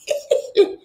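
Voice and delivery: short high airy laugh; a quick, knowing laugh at her own joke; breathy laugh. Real, not acted.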